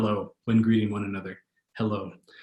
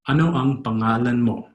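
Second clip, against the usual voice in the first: one connected, fluent phrase; fast, native-style. Shown as a demonstration. Teacher repeating it as a Filipino would, fast and connected. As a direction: link "Ano ang pangalan mo" without breaks. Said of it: "Or fast, as a Filipino would say it".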